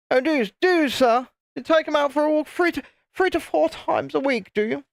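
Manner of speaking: mocking posh English accent, squeaky and high. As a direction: send up a posh English toff with exaggerated disbelief. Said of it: "Had that kind of accent; You bloody toff".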